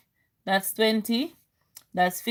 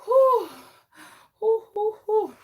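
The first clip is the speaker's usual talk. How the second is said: high shaky whews of relief; 9 semitones higher than usual. Real, not acted. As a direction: blow out the fright in high puffs. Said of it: "Rochelle, it's not funny".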